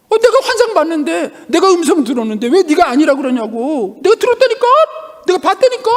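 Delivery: high, loud mock insistence; indignant protest. Acting a believer who insists he saw visions, indignant. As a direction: argue back hotly, pitch way up. Acted quarrel over subjective experience.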